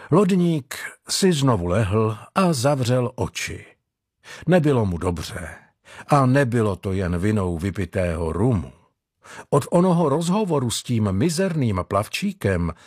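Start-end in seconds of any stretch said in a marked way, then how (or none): none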